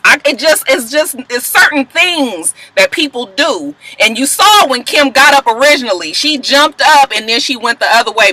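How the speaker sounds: normal talking voice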